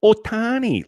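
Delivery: high, mincing Ohtani, mocking the broadcasters; mocking soft sportscaster. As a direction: Ohtani sung up high like a timid announcer. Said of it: "turn into sissies when they say his last name".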